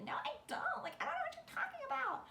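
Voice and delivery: squeaky high, fast protest; high-pitched denial. Retold or imitated. To friends teasing about her crush, squeaky high denial. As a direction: deny it in a flustered high squeak.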